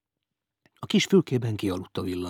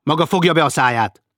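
loud, higher, cutting shut-up; silencing rebuke; 6 semitones higher, 7 dB louder than usual. Acted. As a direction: cut the old man off sharply. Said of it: "intette le Wolfgang".

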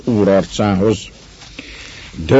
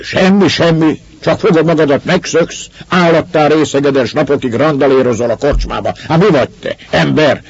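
high-pitched, raised scolding; growing anger; 7 semitones higher than usual. A narrator's reading. Master losing patience with the evasive Russian, growing anger. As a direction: throw the answer back and berate him. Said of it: "Az úr kezdett haragba jönni".

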